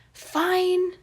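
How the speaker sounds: put-on loud, high grudging boy; sulky kid. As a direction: give in like a sulking child. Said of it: "Remus sighed".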